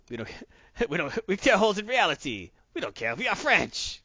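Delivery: hammed-up French accent, laughing, pitch jumping high; mock French accent. Gamer voices his French noble, bad French accent. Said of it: "with my horrible French accent".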